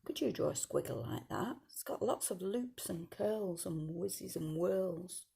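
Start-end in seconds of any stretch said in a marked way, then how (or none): none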